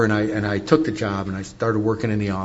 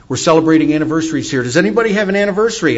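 brisk, higher, louder announcer voice; announcer at podium; 5 semitones higher, 5 dB louder, faster than usual. Retold or imitated. Podium speaker asking a crowd of a hundred fifty, announcing. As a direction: call it out to the room fast.

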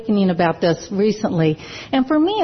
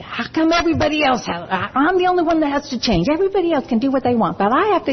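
higher, fast, mock-whiny protest of an angry sponsee; retold angry complaint; 6 semitones higher, faster than usual. Imitating an angry sponsee yelling at her sponsor, mock whiny. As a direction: rattle it off like a resentful sponsee, pitched up. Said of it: "and she would say".

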